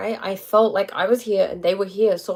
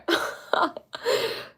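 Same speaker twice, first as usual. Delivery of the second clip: breathy laugh; 11 semitones higher, 6 dB quieter than usual. Real, not acted. Happy over friend's news.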